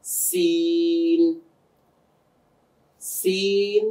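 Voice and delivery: সিন hissed long and very slow; drawn demo. Shown as a demonstration. Teacher introducing সিন with a long slow hiss. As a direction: stretch the s of সিন out. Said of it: "এরপরে আসি".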